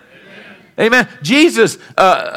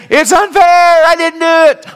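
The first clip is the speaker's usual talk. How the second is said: high, louder, put-on whine of innocence; whiny protest; 12 semitones higher, 5 dB louder than usual. Preacher imagining Joseph complaining in prison, high whiny plea. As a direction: plead like a sulking prisoner. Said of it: "of sitting there and saying".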